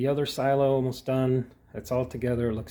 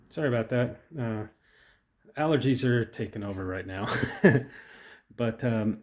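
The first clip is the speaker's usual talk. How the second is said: usual speaking voice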